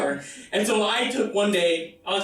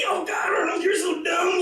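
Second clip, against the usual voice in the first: giggly family yell; 9 semitones higher than usual. Retold or imitated. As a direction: shout at the screen, delighted.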